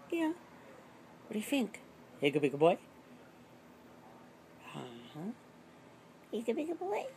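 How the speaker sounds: soft, high dog-directed coo; talking to a dog. Real, not acted. Cooing at the dog.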